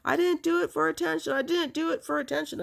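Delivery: high, insistent mock yell; retold scream. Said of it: "And I'm screaming".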